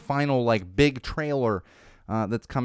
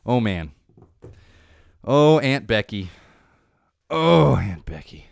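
slow, louder, drawn-out lament; mock dismay; 6 dB louder, slower than usual. Host lamenting Aunt Becky's arrest, slow mock dismay. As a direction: sigh it out like a let-down fan. Lamenting a scandal-hit actress.

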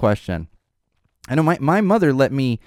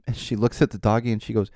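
ordinary delivery

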